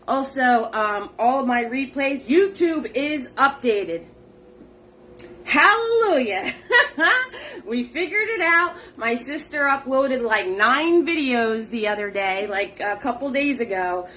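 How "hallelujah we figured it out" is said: a high laughing hallelujah breaking into giggles. Real, not acted. Host celebrating to viewers that the replays finally work, laughing. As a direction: laugh it out in relief.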